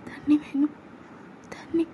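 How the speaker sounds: faint and high; asking weakly for water; weak whispered plea; weak request for water. Acted.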